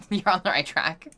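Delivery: saying You're on the right track through a laugh; amused, laughing repeat. Real, not acted. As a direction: let the laugh spill into the words. Repeats the line, second time laughing.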